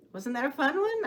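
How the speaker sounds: ordinary delivery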